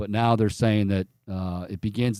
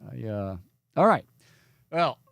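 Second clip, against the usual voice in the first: usual speaking voice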